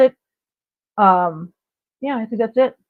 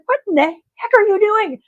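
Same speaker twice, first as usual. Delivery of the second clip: a much higher, bewildered what-are-you-doing; shocked disbelief; 11 semitones higher than usual. Retold or imitated. Mom hearing her son meow in the back seat, much higher and incredulous. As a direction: squeak it out in stunned disbelief. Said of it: "And I was like".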